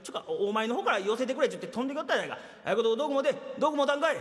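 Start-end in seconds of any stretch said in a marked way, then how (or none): none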